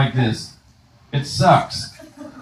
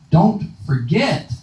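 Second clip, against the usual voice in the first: slow self-reminder; 4 semitones higher, 3 dB quieter, much slower than usual. Retold or imitated. Telling oneself to remember.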